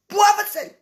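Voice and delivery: loud high call across the room; loud call for the pepper and salt. Real, not acted. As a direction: shout it out to someone far off.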